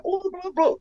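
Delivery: high, mocking babble; imitating pointless chatter; mocking whiny voice. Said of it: "they're like".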